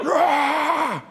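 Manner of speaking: high imitated jet roar; jet engine roar. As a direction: rah like a jet engine spooling up. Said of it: "and that's a jet engine".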